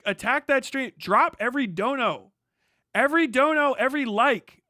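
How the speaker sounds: loud, high, urgent orders; furious demand. Real, not acted. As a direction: bark orders at the crowd. Furious call to action.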